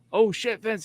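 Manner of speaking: loud, high startled cry; alarmed shout. Retold or imitated. Teammate startled as the kick lands, alarmed shout. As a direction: blurt it out in shock.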